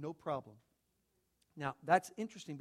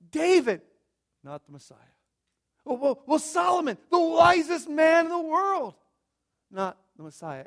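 preaching at full force; 10 semitones higher, 14 dB louder than usual. Acted. Preacher pressing the congregation about life's obstacles, loud and building. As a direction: build it like a pulpit climax.

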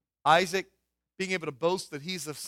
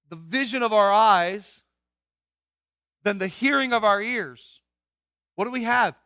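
emphatic build; 3 semitones higher, 6 dB louder than usual. Real, not acted.

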